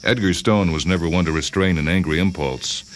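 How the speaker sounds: plain reading voice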